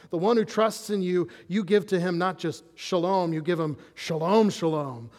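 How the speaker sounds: ordinary talk, nothing marked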